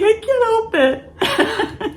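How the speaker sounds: high, slow pleading; wife pleading with her husband; helpless whine. Retold or imitated. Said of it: "I'm like".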